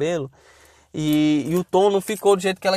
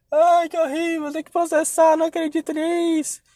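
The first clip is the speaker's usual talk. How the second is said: mock crying; 8 semitones higher, 3 dB quieter than usual. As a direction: keep up the hammed-up tearful whine. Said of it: "chorando mesmo". (imitação de choro manhoso)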